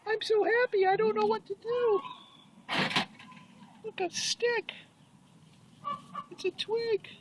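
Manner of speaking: high, delighted cat voice for the pet; voicing the cat. Owner speaking for his indoor cat rolling in tar, high, delighted voice.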